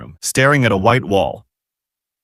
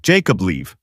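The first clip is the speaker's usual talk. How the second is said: ordinary delivery, nothing marked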